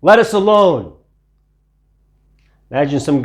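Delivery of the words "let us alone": high, loud cry of a demon; a spirit shrieking to be left alone; screaming demon. A narrator's reading. Man with unclean spirit crying out at Jesus, loud high cry. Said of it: "He screamed out".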